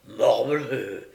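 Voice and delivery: loud angry outburst; old gentleman finally exploding in anger; angry oath. Acted. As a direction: let the held-in anger burst. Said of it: "donnant cours à sa colère".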